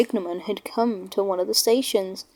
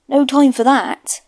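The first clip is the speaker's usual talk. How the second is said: louder, brisk official; brisk signalman; 4 semitones higher, 7 dB louder than usual. Acted. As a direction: order the engine on its way. Signalman sends Toby away.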